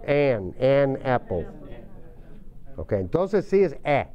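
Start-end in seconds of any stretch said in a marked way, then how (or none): 0.1-1.5 slow, careful "an apple"; slow model. Shown as a demonstration. Teacher modelling for students before a vowel. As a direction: spell out "an apple" slowly. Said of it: "Cuando comienza con vocal".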